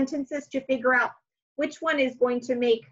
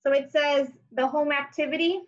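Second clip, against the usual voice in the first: normal talking voice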